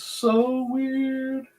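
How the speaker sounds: high, very slow drawl on so weird; drawn-out wonder. Real, not acted. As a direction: stretch it out, amazed. Reacting to her drawing.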